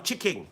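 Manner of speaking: "chicken" said as "chick-king"; deliberate mispronunciation. Shown as a demonstration. Host naming the sticker, "chicken" pronounced "chicking". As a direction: end "chicken" in -ing, like "chicking". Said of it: "I'm calling this one chicken".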